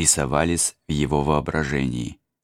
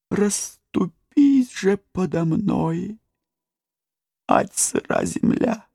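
high, slow, moaning lament; tragic heroine sorrow; slower than usual. A narrator's reading. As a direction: wail it out in despair. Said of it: "простонала она".

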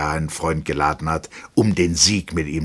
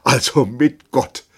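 loud, high, slow blessing; tearful farewell; 8 semitones higher, 7 dB louder, slower than usual. A narrator's reading. Captain sends his friend off, tearful and emphatic. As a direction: send him off slowly, brimming with tears. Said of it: "die Augen voller Tränen".

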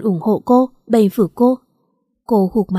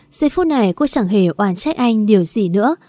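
ordinary delivery

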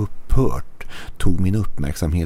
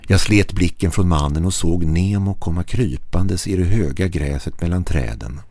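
usual speaking voice

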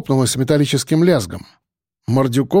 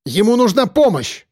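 high, urgent shout; крик о помощи; shouting for help; 7 semitones higher than usual. A narrator's reading. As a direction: shout so someone comes to help. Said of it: "крикнула Кобра".

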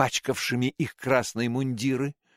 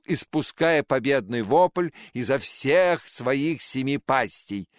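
ordinary delivery, nothing marked

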